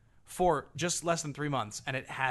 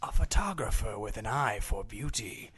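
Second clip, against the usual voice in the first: dramatic trailer narration; movie trailer voice; 5 semitones lower than usual. Pretend. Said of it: "nineties movie trailer voice".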